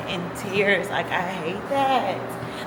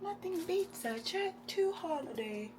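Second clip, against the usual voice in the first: much higher, sing-song imitation of the holiday ad; jokey ad jingle voice; 10 semitones higher, 13 dB quieter than usual. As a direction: chirp the ad slogan like the meme. Quoting the holiday ad meme.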